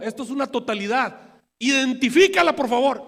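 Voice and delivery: loud, high, insistent pulpit emphasis; fervent preaching. Acted. Preacher urging the congregation to see it, loud and fervent. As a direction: hammer the point home to the congregation.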